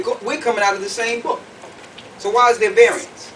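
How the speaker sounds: usual speaking voice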